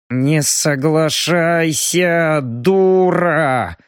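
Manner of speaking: very slow hiss through gritted teeth. A narrator's reading. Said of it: "сквозь зубы прошипел Робин".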